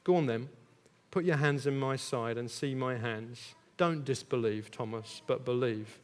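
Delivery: low, soft and kindly; gentle Jesus. Retold or imitated. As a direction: coax him gently. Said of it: "And Jesus says".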